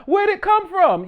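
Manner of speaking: high mock-naive voice; mock innocent question. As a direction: play dumb, wide-eyed.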